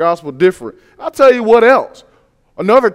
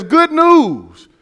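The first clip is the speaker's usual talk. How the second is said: a bright, raised headline cry, mock newspaper; joyful headline; 7 semitones higher than usual. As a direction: announce it like happy news on the porch. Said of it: "and it says".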